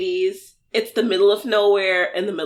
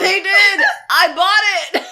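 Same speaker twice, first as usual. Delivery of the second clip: high, loud and gleeful; excited shout of agreement; 9 semitones higher, 7 dB louder, slower than usual. Real, not acted. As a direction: blurt it out, thrilled.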